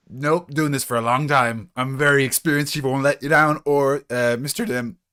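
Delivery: high, loud overeager rookie voice; a rookie reassuring his chief, high and eager. Acted. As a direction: try too hard to sound experienced. Player in character voice.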